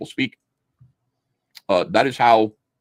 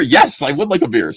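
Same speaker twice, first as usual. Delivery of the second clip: put-on eager teen reply; 4 semitones higher, 5 dB louder than usual. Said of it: "says any seventeen-year-old".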